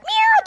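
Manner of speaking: high squeaky meow; cat meow. Acted. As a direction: mew like a little cat. Cat sound in the story.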